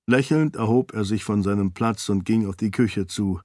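plain reading voice

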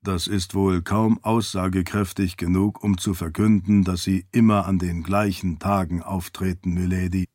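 low-pitched, measured servant addressing his lady; doubtful servant. Acted. Servant doubting his lady's theory.

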